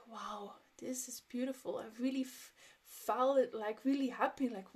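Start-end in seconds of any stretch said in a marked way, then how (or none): 0.1-1.8 hushed, breathless wonder; awed whisper. Retold or imitated. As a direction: whisper it as if the view stole your breath. Awed by castle view.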